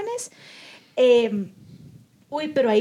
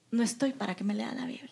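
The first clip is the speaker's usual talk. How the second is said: near-whispered, drained brush-off; hushed retold refusal; 10 dB quieter than usual. Upset son turning down his mother's Bible verses, hushed. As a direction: refuse under your breath, acting out someone upset.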